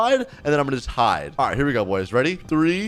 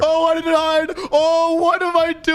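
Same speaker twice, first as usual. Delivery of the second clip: high, louder panicked yelp; panicked surprise; 12 semitones higher, 4 dB louder than usual. Real, not acted. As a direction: realise you're caught and panic. Caught in the open.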